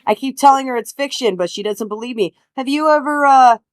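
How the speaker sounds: emphatic, stalling, over-formal excuse; officious boy detective. Acted. Boy making excuses to officer about the note, officious. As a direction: talk your way out with halting self-importance. Player speaks as Blaine to officer.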